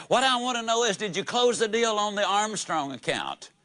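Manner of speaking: a boss calling loudly for his sales manager. Retold or imitated. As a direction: boss booming so everybody hears. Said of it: "in a loud voice".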